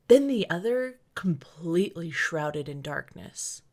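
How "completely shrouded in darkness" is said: usual speaking voice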